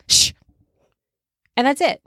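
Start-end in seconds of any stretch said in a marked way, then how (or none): 0.1-0.3 long "shh" hushing the storm; a drawn-out shushing sound; imitated hush. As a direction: shush it like calming the wind. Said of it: "goes outside and goes".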